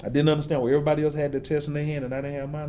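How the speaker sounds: ordinary delivery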